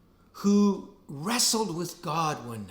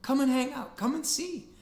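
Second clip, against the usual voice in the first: higher, easygoing friend's call; casual hey, come hang out, pitched up; a buddy inviting you over, lightly voiced; friendly invitation; 9 semitones higher than usual. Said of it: "and he just says".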